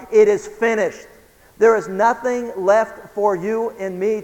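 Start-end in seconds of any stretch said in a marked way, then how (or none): 0.1-1.1 raised-voice quote of a cry; retold cry. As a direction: proclaim it louder, as a quoted cry. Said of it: "what Jesus cried".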